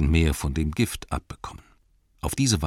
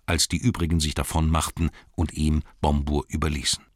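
ordinary delivery

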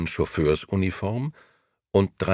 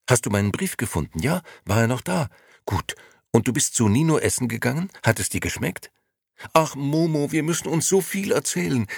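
higher, tumbling rush of eager words; excited old friend pouring out questions; 7 semitones higher than usual. Acted. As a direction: gush it out, barely pausing for breath. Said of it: "redete aufgeregt weiter".

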